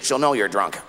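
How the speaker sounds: ordinary delivery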